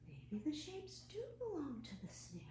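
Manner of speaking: hushed storytelling whisper. Acted. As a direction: breathe the words, no voice. Whispered story line after gasp.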